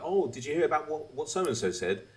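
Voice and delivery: chatty podcast host. Retold or imitated. Podcast host asking a co-host about news, mock chatty. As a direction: imitate an eager podcast host.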